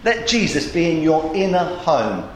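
normal talking voice

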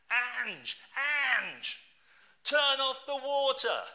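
loud desperate shout for his wife. Retold or imitated. Preacher shouting to his wife over the water leak. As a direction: yell over the spraying water. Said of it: "while shouting to my wife".

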